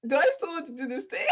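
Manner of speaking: high, quick laughing self-question; laughing exasperation. Retold or imitated. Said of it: "I'm like".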